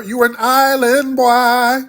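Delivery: slow mocking jab at the caller; taunting. Real, not acted. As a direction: stretch it out to needle him.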